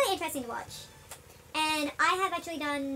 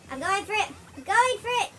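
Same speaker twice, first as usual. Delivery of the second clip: high, loud burst of excitement; worked-up, raised voice ending in a whoop; excited gamer hype; 7 semitones higher, 5 dB louder than usual. Real, not acted. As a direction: cheer yourself on through the move. Going for a trick, lands it.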